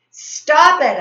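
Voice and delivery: loud high yell. A narrator's reading. Boy yelling at the bully to stop, loud yell. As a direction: shout it to stop the fight. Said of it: "I yelled".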